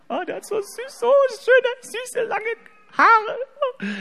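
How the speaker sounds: high doting coo over a photo. Real, not acted. Cooing over son's photo.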